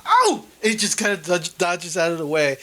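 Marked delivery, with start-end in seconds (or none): 0.0-0.5 high, sudden startled cry; startled yelp. Acted. Carp dodges the net.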